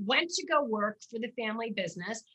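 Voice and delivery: ordinary talk, nothing marked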